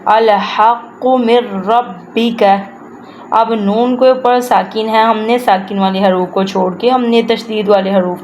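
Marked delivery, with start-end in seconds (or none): 0.0-2.4 slow, measured recitation of "الحق من ربک"; careful tajweed pronunciation. Shown as a demonstration. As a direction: articulate each letter of "الحق من ربک" slowly and fully. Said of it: "ویسے ہی تلفظ سے پڑھنا ہے". ("الحق من ربک" ٹھہر ٹھہر کر صحیح تلفظ سے)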